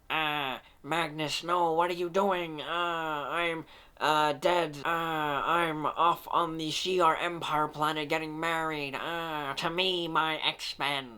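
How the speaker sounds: whiny, nerdy mock Professor X. Reviewer mocks Professor X's voice as nerdy, whiny impression. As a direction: make him sound like a fussy nerd. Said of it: "He sounds like such a nerd".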